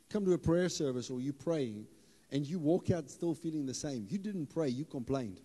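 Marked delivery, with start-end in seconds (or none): none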